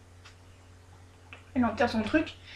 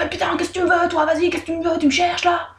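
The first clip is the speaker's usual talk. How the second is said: higher, irritated snapping back; annoyed retort; 7 semitones higher, 3 dB louder than usual. Retold or imitated. Annoyed girl snapping at the monk, higher and prickly. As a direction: pick a fight with the stranger. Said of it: "elle est un peu vénère".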